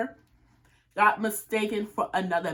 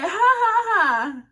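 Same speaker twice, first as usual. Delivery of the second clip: high, loud mock "ha ha ha"; fake laugh; 11 semitones higher, 8 dB louder, slower than usual. Reacting to his mix-up, loud fake laugh. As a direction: laugh it out in disbelief, put on.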